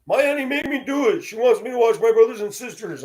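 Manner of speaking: lightly voiced young child, high; a hint of a small boy explaining; a little boy explaining why he watched it.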